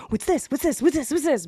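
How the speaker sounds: high, eager pet-owner quizzing; mimicking the owners. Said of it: "they're like".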